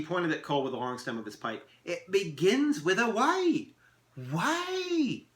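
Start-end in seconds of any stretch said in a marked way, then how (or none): 1.8-5.3 high, slow, drawn-out "why"; theatrical elf puzzling aloud; elf harping on why. Acted. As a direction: pitch "why" right up and stretch it. Elf harps on the word.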